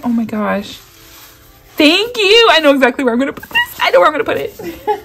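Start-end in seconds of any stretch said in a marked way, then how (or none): 1.8-5.0 high, fast, laughing thank-you; bubbling over with excitement; real excitement. Real, not acted. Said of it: "I'm so excited".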